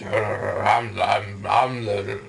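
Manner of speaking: drawn-out, blustering parody of a furious man; mocking impression of a mad man; mock angry driver. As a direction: ham up a sulking driver's tantrum. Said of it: "sits in his seat all like".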